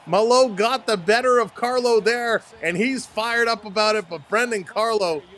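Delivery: high, loud live hockey call; excited fight play-by-play. Real, not acted.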